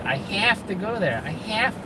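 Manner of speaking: put-on high, drawn-out Staten Island accent. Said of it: "she grew up in Staten Island".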